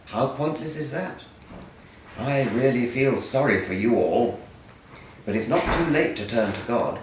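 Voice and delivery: loud, mock-indignant reading of hate mail aloud. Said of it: "read some of your hate mail".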